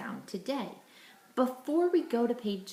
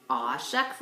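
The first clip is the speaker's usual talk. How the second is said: playful aw shucks; 7 semitones lower than usual. Shown as a demonstration. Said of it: "I want you to say".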